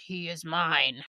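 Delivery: low, guttural possessed voice. Retold or imitated. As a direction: growl it like something possessed. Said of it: "in a super guttural voice said".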